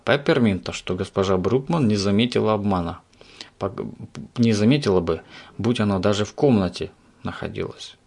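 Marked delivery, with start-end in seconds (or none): none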